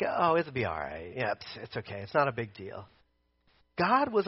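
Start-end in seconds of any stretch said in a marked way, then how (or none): 0.0-2.9 low, soft, mock-dismissive mumble; casual shrug-off. Preacher mimicking someone unbothered by the thorn, low and dismissive. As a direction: brush it off quietly, as if unbothered. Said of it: "Like, this wasn't like".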